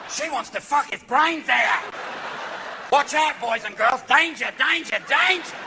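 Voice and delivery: put-on Australian accent, slow and excited; Aussie wildlife host warning the kids; Crocodile Hunter impression. Crocodile Hunter warning about the gorilla, Australian accent. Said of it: "the crocodile hunter to walk out and go".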